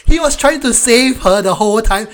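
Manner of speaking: outraged disbelief at a silly plot; what-the-heck exasperation; incredulous. Real, not acted. Ranting about a film scene.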